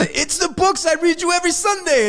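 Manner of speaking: high, gushing mock voice; proud gangster. Gang leader bragging to his men, high and sweet. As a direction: brag sweetly to your men.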